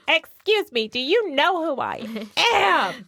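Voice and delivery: high, drawn-out haughty protest; hammed-up offended star; mock diva outrage. Imitating the dog star pulling rank, mock outrage. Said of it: "She's like".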